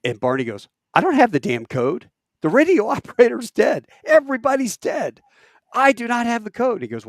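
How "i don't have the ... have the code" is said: high, loud angry protest; exasperated radio shout. Retold or imitated. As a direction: yell it back over the radio, fed up. Exasperated lieutenant yelling over radio.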